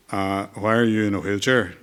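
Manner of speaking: lightly imitated Northern Irish accent; a hint of Belfast in the retold question; Gerry Adams. Politician asks the boy in a wheelchair, light Northern Irish accent. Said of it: "sorry about the accent".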